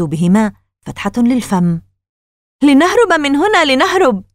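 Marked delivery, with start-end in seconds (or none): 2.6-4.2 high, urgent cry to flee; panicked cry. Acted. Casey pulling Margaret from the cupboard, panicked cry. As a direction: cry out in fright, pitch kept high. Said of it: "هكذا صرخ كيسي".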